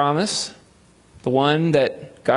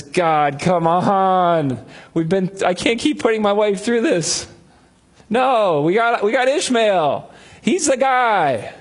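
pleading Abraham; 6 semitones higher, slower than usual. Retold or imitated. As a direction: push back at God, exasperated and rising.

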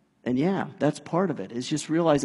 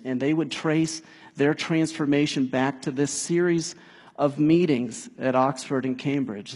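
ordinary talk, nothing marked

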